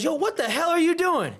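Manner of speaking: high, loud mock yell of a furious dad; angry dad. Dad storms onto the mat and yells at his son, mock furious. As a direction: bark it like an outraged father. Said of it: "he goes".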